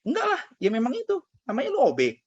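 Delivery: very high mock voice of a scolding mother; mock the mom putting him down. Mother dismissing her son's complaint, high mocking scold. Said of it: "kata dia".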